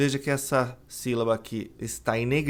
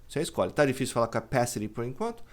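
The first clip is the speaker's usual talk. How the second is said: normal talking voice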